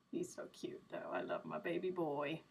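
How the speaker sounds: soft, low affectionate murmur; tender doting. Real, not acted. Owner cuddling the cat on stream, real tender doting. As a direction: coo it quietly to a beloved pet.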